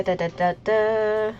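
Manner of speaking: usual speaking voice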